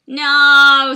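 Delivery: drawn-out yell, high and very loud; mock dramatic shout. A narrator's reading. John echoes Sherlock's cry, long dramatic shout. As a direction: hold one big hammed-up cry. Reader acts out John's cry.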